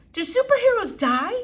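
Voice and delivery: put-on high, frightened child's question; a scared girl blurting it out; terrified girl. Flora asks her father in a frightened high voice. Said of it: "She was suddenly terrified".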